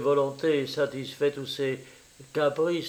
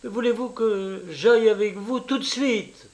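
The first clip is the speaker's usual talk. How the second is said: higher, impatient repeated question; mildly furious; 8 semitones higher than usual. A narrator's reading. Italian woman repeats her question to a baffled traveller, mildly furious. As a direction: press the question with a touch of fury. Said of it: "d'un air de plus en plus furieux".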